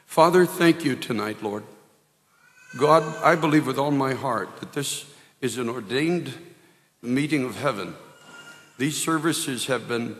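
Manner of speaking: loud, earnest opening prayer; fervent prayer. Real, not acted. Preacher opening the sermon by praying aloud, loud fervent prayer. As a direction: call on the Father with full conviction.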